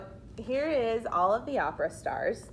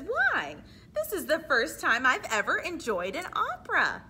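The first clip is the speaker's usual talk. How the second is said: delighted husband; 6 semitones higher, 5 dB louder than usual. Acted. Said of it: "And her husband says".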